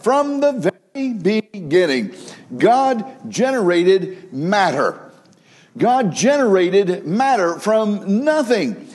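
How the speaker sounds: emphatic slow sermon delivery, lifting on each phrase; preaching. Acted. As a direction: drive the point home to the congregation.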